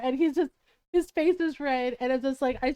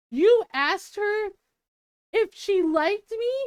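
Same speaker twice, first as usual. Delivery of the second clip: slow, high, louder incredulous questioning; mock stunned disbelief; 6 semitones higher, 5 dB louder, slower than usual. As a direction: draw it out like a boyfriend who can't believe it. Retold boyfriend stunned by friend.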